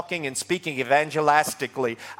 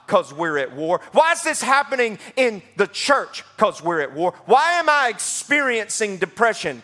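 call-and-response preaching; 5 semitones higher than usual. Acted. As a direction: preach it like a drumbeat, question then answer.

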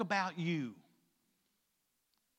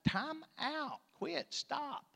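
high, soft mock scolding; cutting in; 7 semitones higher, 5 dB quieter, much slower than usual. Preacher voices the Lord stopping his complaints, cutting in. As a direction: call a halt in separate high, quiet words.

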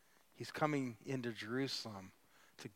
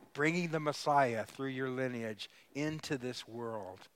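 voice of God; 3 semitones higher, 6 dB louder than usual. Acted. God telling his people he will bring the Messiah, louder and commanding. Preacher voicing God's declaration.

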